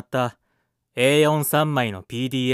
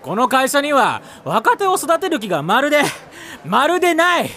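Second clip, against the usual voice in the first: put-on drunken rant; 8 semitones higher, 3 dB louder than usual. Drunken rant at year-end party.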